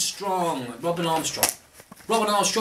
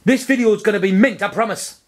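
1 hype promise; 5 semitones higher, 6 dB louder than usual. Pretend. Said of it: "Just say, like".

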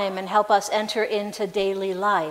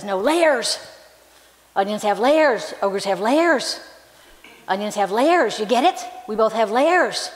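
louder, leaning hard on layers each time; insistent Shrek; 3 dB louder than usual. Retold or imitated. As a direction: pound the word layers home. Shrek insists to Donkey about layers.

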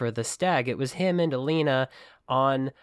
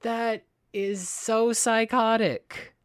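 appalled; 8 semitones higher, slower than usual. Real, not acted. Reacting to horrific threat.

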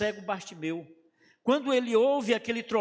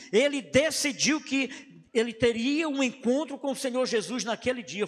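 normal talking voice

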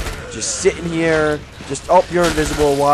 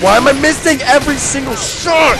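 higher, louder exasperated shout; frustrated shout; 11 semitones higher, 6 dB louder than usual. Real, not acted. Gamer missing every shot, frustrated shout. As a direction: vent at your own bad aim.

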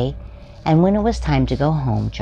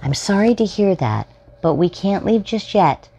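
higher, patient mother; gentle mom voice; 9 semitones higher than usual. Acted. Mom telling her bored son they can't leave yet, higher and gentle. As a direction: soothe the child like a calm mother.

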